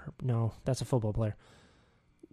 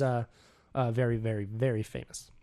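ordinary talk, nothing marked

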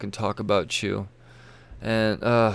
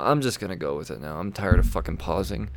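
ordinary talk

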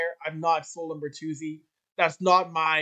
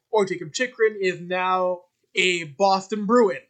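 ordinary talk, nothing marked

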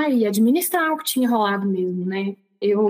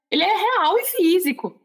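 high, emphatic amazement; reliving wonder in a swooping high pitch; astonished realisation; 8 semitones higher than usual. Retold or imitated. Woman marvelling at her renewed legs, amazed.

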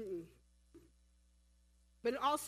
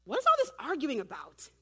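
louder, lightly raised question as Jesus; a hint of acted Jesus, voice lifted; first-take Jesus voice; 3 semitones higher, 6 dB louder than usual. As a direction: read Jesus' question out brightly projected. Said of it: "let me change my voice".

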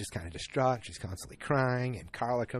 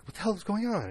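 alarmed; 12 semitones higher, 5 dB louder than usual. Retold or imitated. Coworkers shocked at news.

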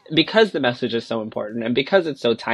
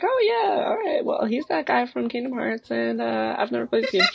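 pitched way up, airy and clueless; high mock clueless voice; 8 semitones higher, 5 dB quieter than usual. High clueless voice, mocking her old self to the group. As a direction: play your past self, totally oblivious.

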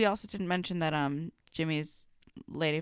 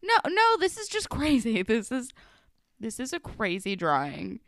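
mock alarm; 4 semitones higher, 4 dB louder than usual. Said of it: "And I was like".

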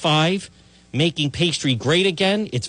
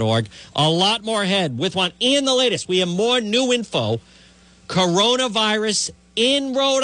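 higher, punchy radio announcer voice; radio promo read; 4 semitones higher than usual. Acted. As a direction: sell the upcoming show like a promo. Reads the show promo.

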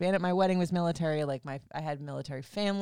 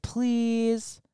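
higher, louder, mock pleading; 6 semitones higher, 5 dB louder, much slower than usual. Ex begging her to hang out at the bar, whiny mock plea. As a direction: whine it like a begging ex. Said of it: "And he's like".

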